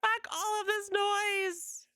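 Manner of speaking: high, slow mock wail of a broken program; hammed-up crying computer voice; mock OBS voice. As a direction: whine it out like the software is sobbing. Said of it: "And O B S is like".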